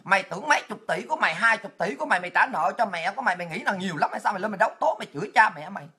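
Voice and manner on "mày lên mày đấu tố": ordinary talk, nothing marked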